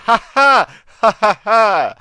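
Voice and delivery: high, loud gleeful laughter. Real, not acted. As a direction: burst out cackling. Excited about the counter.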